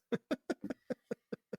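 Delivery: breathy high laugh; high giggle. Real, not acted. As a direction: laugh it off lightly. Laughs after quote.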